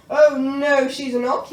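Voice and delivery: knight yelling. A narrator's reading. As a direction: shout him down. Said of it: "yelled Gadabout".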